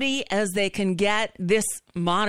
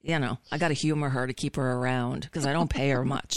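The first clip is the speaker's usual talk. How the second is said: normal talking voice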